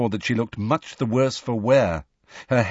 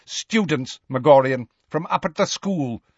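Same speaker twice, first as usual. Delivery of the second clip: higher-pitched, disdainful correction; contemptuous; 5 semitones higher than usual. A narrator's reading. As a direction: point it out with contempt. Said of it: "interrupted Bane contemptuously".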